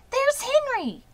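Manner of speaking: high, loud excited shout; excited girl spotting Henry; excited cry. A narrator's reading. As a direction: call out thrilled. Fern excited.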